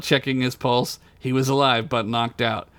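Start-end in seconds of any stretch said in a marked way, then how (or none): none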